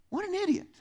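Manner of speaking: squealed up high in mock disbelief; scornful exclamation. Preacher mocking the foolish servant, squealing up high. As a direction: treat it as the dumbest thing you ever saw. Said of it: "read this story and go".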